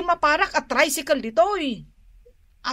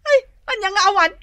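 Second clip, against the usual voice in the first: exasperated retort; 6 semitones higher, 4 dB louder, much faster than usual. Acted. Arguing about the smell.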